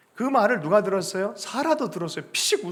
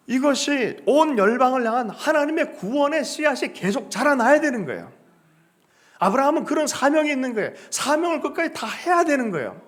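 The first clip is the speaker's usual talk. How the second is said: higher, louder climactic preaching; fervent preaching; 8 semitones higher, 6 dB louder than usual. Acted. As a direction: build to a passionate climax. Preaching at full force. (높고 크게 열정적으로 설교하듯)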